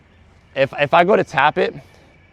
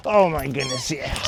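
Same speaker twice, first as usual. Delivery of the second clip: tender praise; slower than usual. Real, not acted. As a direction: coo over her gently. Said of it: "You're so animated".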